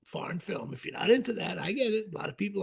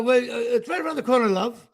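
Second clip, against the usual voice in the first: fast, put-on English accent; mock British accent. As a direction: toss off a cheeky British reply. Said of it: "and, like".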